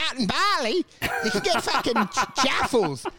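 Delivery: hammed-up backpacker raving about cheap jaffles; naive traveller. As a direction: gush like someone who travelled once. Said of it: "and they're like".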